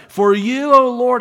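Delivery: high, loud plea; calling out to God. Acted. Sailors pleading for their lives to the Lord, calling out. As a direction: raise the voice in urgent prayer. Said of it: "they called out to the Lord".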